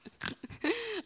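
high snort of laughter; real laugh that comes out as a snort. Real, not acted. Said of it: "oh no, I snorted".

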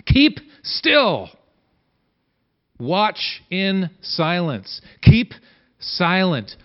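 very slow, drawn out "Hold your peace"; slow, savoring. Shown as a demonstration. Preacher comparing translations for the congregation, slow and drawn out. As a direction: savor each translation as a command. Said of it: "different translations translate this particular verse".

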